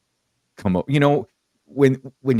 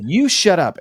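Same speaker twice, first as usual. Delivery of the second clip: higher-pitched repeated order; firmer, raised-pitch telling-off, acted out; retold insistent rebuke; 8 semitones higher than usual. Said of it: "I turned on him so quick".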